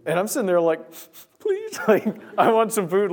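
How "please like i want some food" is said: very high, squeaky comic plea; mock pleading. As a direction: beg in a hammed-up high voice, half laughing. Acted eager plea for food.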